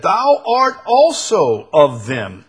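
loud, high, slow accusation; accusing. Acted. A bystander singles out Peter by the fire, accusing. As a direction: point him out loudly, word by word.

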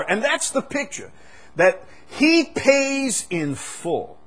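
high, loud, slow soaring proclamation; fervent sermon climax; preaching at full force. Acted. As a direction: drive it home to the congregation.

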